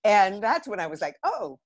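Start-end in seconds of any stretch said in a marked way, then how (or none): 1.2-1.6 much higher, half-laughing surprised discovery; light up with a mock eureka; playful mock revelation about her past self; dawning realization. Retelling her own realization.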